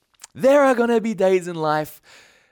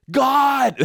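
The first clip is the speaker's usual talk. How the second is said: louder, higher cry of frustration; imagined self crying out to God, exasperated; 9 semitones higher, 4 dB louder than usual. Retold or imitated. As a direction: throw up your hands, loud. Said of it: "now a snake bites me".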